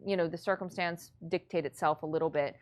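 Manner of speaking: normal talking voice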